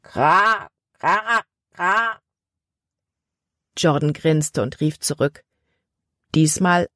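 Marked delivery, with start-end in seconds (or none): 0.0-2.2 distant crow caws, high; answering crow. A narrator's reading. A crow in the woods answering, distant cawing. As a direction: caw back like a far-off crow. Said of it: "antwortete eine Krähe im Wald".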